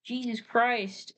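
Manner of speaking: slow stunned mock gasp; slow exclamation. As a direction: drag it out in disbelief.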